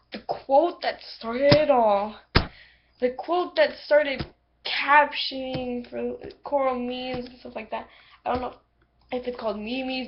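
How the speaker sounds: ordinary talk, nothing marked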